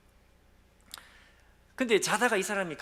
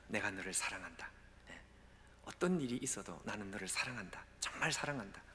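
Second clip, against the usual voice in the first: soft, lower, gentle reassurance; tender voice of God; 4 semitones lower, 7 dB quieter than usual. Acted. God comforting the broken pastor, soft tender voice. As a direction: speak love quietly to a broken man. God's voice speaking love.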